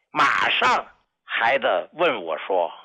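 ordinary talk, nothing marked

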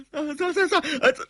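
high, faltering stammer; terrified stutter. Acted. Villain questioned at knifepoint, high faltering stammer. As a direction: stammer one word, too scared to finish.

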